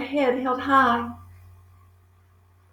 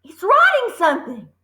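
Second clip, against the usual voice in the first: high frantic squeal; excited scream; 10 semitones higher, faster than usual. A narrator's reading. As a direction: shout it in wild alarm. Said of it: "screamed Nigel".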